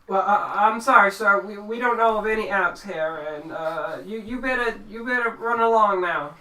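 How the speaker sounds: high, loud, stammering brush-off; country character fumbling through an excuse; flustered farmer. Acted. Farmer lies to send stranger off.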